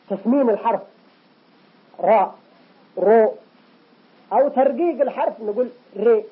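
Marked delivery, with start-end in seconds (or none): 2.0-2.3 راء said with a thick, full emphatic R; heavy ra. Shown as a demonstration. Teacher demonstrates a heavy راء. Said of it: "والتفخيم تسمين الحرف". 3.0-3.3 رو said thick and rounded, a heavy emphatic R. Shown as a demonstration. Teacher demonstrates a heavy رو. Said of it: "والتفخيم تسمين الحرف". 6.0-6.2 ري with a thin, light R and a bright i. Shown as a demonstration. Teacher shows a light ري. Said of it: "هذا الترقيق".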